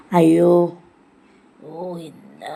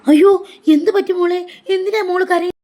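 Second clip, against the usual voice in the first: alarmed concern; 3 semitones higher, 5 dB louder than usual. Acted. Grandmother rushing to a crying child, alarmed concern. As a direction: cry out in worry, voice jumping up.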